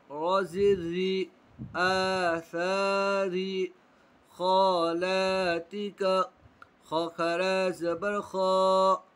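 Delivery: slow, drawn-out recitation of syllables; Quran spelling drill. Shown as a demonstration. Teacher drilling pupils in Quran spelling, slow chant. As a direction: spell it out syllable by syllable, stretching long vowels.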